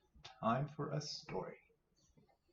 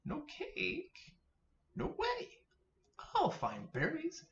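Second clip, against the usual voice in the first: tiny squeaky bunny, much higher; little bunny voice; slower than usual. Acted. Minnie Rabbit to Mother Rabbit, squeaky high bunny voice. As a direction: chirp it like an eager baby rabbit. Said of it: "Mother Rabbit says".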